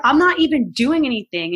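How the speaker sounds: indignant protest. Retold or imitated. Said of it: "And I was like".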